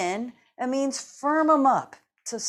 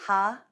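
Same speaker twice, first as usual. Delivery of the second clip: one strong ha breath; laughter exhale; 5 dB louder than usual. Shown as a demonstration. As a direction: breathe out a single ha. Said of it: "It'll sound like this".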